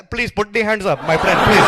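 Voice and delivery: mock Indian cop accent; polite police order in a put-on Indian accent. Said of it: "Nobody's ever seen an Indian cop".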